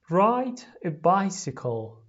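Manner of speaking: very slow, loud teacher model; slow model phrase. Shown as a demonstration. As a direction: drag out each word for repeating. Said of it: "لطفاً تکرار کنید".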